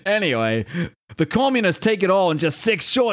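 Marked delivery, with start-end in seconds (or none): 1.2-3.1 put-on British accent. Resumes British villain's speech.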